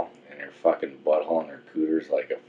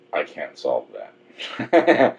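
slow mock puzzled; 3 semitones lower, much slower than usual. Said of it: "She's like a Rubik's Cube".

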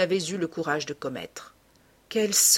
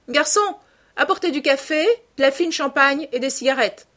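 higher, louder call to a waiter; 6 semitones higher, 5 dB louder than usual. Acted. Lord Henry orders coffee from the waiter, calling out. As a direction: call across the room with a brisk order.

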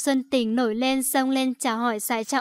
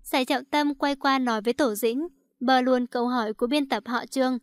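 plain reading voice

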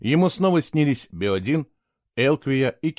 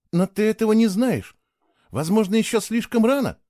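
higher, eager pushback; energetic protest; 10 semitones higher than usual. A narrator's reading. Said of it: "энергично протестовала она".

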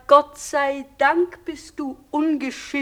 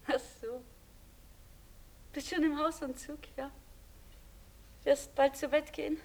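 usual speaking voice

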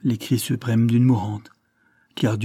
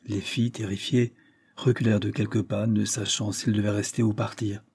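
ordinary delivery, nothing marked

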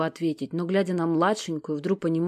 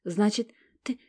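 sad conclusion; 7 semitones higher, 6 dB louder than usual. Acted. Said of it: "грустно заключила Стефания".

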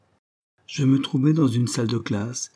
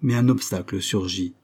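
plain reading voice

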